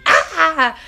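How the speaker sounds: a loud high mock newborn shriek; imitated baby scream. Mother imitates her baby screaming at daddy, loud mock shriek. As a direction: squeal it like a cross little baby. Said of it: "She just screamed at him".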